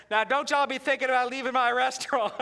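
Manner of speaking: loud, cheery Southern hostess voice; Southern waitress. Retold or imitated. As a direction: bellow it like a jolly diner waitress. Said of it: "She said".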